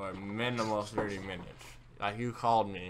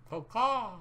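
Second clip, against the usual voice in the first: calling out; 6 dB louder than usual. Real, not acted. Sudden high call out.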